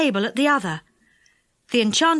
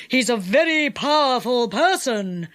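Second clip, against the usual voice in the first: lightly voiced enchanter asking; enchanter's voice. A narrator's reading. Enchanter asks how Changeabout was captured, in character. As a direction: question him in a villain's voice.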